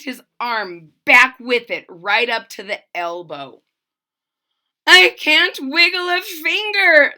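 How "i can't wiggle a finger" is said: high loud wail. A narrator's reading. Trumpeter stuck to his horn, loud wail. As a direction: wail it in despair. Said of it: "the trumpeter wailed".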